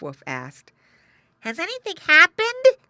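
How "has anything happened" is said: very high, loud piglet voice; piglet asking. A narrator's reading. Piglet questions worried parents, high piglet voice. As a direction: ask like an alarmed little pig.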